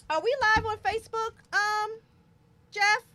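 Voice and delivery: called out high and loud across the room; shouting a question to Jeff off mic; calling out. Real, not acted.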